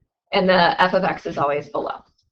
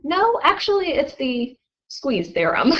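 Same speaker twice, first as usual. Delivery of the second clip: correcting teacher; 6 semitones higher, slower than usual. Retold or imitated. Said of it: "And he goes".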